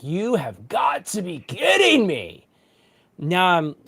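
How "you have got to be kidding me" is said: slow, drawn-out, higher exasperation; hammed-up slow groan of disbelief; exasperated disbelief. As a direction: stretch each word in mock disbelief. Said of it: "And I'm like".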